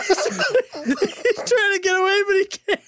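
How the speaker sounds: laughing. Real, not acted. Helpless laughter at cat gif.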